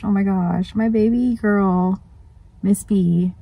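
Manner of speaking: ordinary talk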